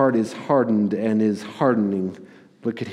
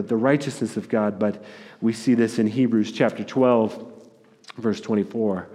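ordinary delivery

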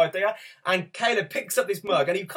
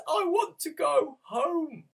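much higher, slow, small weeping voice; tearful toddler; 12 semitones higher, much slower than usual. Retold or imitated. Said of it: "tears are pouring down his cheek".

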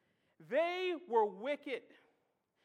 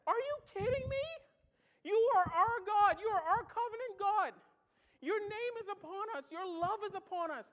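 higher and louder, indignant prophet protesting; indignant Jonah; 6 semitones higher, 3 dB louder than usual. Retold or imitated. Preacher as Jonah to God, indignant and higher.

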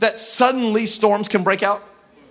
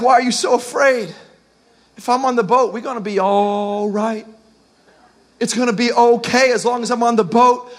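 acted calm, stretching key words; calm reassurance. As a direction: soothe the frightened, unhurried. Voices Jesus calming frightened disciples.

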